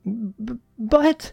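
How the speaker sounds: high, hesitant schoolgirl; stammering Susie. A narrator's reading. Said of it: "Susie began to speak".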